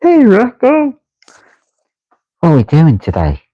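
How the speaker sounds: high excited cartoon buddy. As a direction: chirp it up like an eager cartoon pal. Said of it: "where I get my accent".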